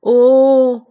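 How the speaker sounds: a loud prolonged ओ at the end of a call; long called vowel. Shown as a demonstration. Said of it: "पीछे की आवाज आती है". (पुकार में लंबा ओ)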